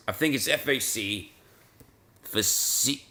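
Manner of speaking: ordinary talk, nothing marked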